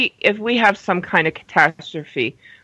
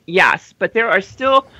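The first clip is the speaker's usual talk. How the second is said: normal talking voice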